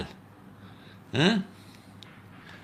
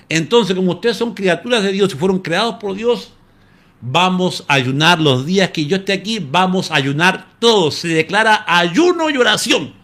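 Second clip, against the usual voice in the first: high, louder preacher's plea; rally the lions like a preacher; Daniel urging the lions to fast, raised and high; Daniel preaching; 8 semitones higher, 5 dB louder than usual. Retold or imitated.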